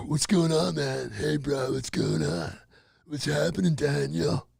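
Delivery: low, slow laid-back stoner. Shown as a demonstration. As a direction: drawl it like a stoned dude. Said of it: "It's a stoner voice, man".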